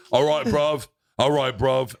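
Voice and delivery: loud cockney bruv greeting; imitating a London doorman; a hint of London street accent. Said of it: "That's Australian".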